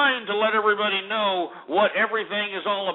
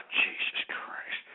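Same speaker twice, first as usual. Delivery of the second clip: slow, breathless whispered curse; exasperated whisper; 9 dB quieter, much slower than usual. Real, not acted. On-air host, fed up with his callers, whispers slowly. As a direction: let it slip out under your breath, slowly.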